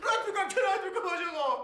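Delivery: high, fast, half-laughing outcry; frantic protest. Acted. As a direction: blurt it out in disbelief at the fine. (با خنده و اعتراض تند و بلند)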